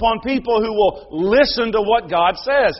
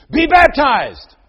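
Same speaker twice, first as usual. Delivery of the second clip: put-on higher, louder, slow boy's proclamation; child preacher echo; 5 semitones higher, 8 dB louder, slower than usual. Little Luke loudly repeating his grandfather's words. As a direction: proclaim it like a four-year-old preacher.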